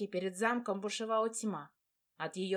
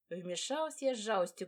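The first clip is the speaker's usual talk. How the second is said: plain reading voice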